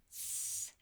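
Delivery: "th" breathed out, tongue between teeth; th sound demonstrated. Shown as a demonstration. Teacher modelling the unvoiced "th" for children. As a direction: blow air softly over the tongue for "th". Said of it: "the tongue sits between the teeth".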